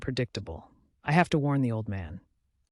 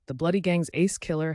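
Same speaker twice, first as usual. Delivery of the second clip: ordinary delivery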